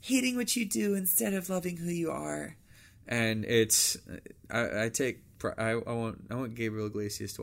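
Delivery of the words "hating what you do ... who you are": put-on airy Valley Girl, stretched vowels. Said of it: "I got my Valley Girl voice".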